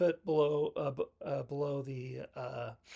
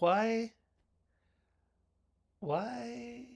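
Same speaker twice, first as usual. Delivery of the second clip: mock lament; 7 semitones higher than usual. Mock lament over pregnant victim's death.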